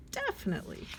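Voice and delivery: squeaky high definitely; high chirpy "Definitely."; high-pitched. Shown as a demonstration. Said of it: "same word said high".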